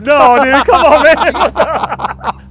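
loud, high, stretched-out hype yelling; triumphant trash-talking yell; excited shout. Real, not acted. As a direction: crow over the shot at full volume. Hyped trash talk after great shot.

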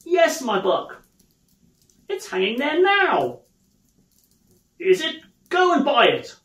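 high, eager exclamations; excited Scrooge. Acted. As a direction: call out in delighted excitement. Scrooge excitedly sends boy shopping.

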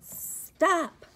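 high, loud call to halt; shouted stop. Acted. Character calls out to Mister Gilly, loud stop. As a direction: yell it to stop the truck.

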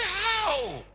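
high, loud, baffled "How?"; startled cry. Retold or imitated. Preacher retelling his bafflement, crying out high and loud. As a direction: cry out in disbelief. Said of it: "I'm just so surprised".

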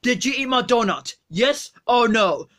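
usual speaking voice

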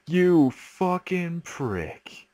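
furious slow curse. Acted. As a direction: spit each word slowly in rage. Repeated with more force.

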